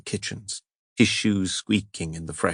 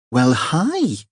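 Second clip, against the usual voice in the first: bright, much higher, pleased hello; delighted greeting; 8 semitones higher than usual. Acted. Holly opening the door to Moran, delighted. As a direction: greet him with genuine pleasure.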